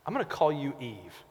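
a hint of Adam speaking softly to his wife; lightly voiced Adam, hushed and tender; preacher voices Adam renaming his wife, softly tender. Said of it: "he turns to her and he says".

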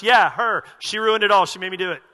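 loud, petulant blaming; whiny blame. Acted. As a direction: shove the blame onto her, loud. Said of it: "Adam's now going".

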